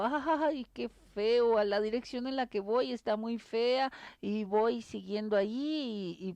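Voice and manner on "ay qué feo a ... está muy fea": a preacher's tearful "ay" in a parable; mock crying lament. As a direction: act out crying over the ruined road. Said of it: "me pongo a llorar y digo".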